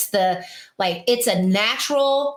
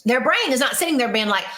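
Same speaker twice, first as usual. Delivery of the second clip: ordinary delivery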